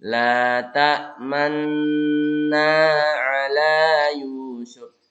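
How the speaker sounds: "ta'manna" with lips slightly rounded on the nun, drawn out; ismam rounding. Shown as a demonstration. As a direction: push the lips forward a little on "ta'manna". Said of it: "Ingat ismam, dimonyongkan sedikit".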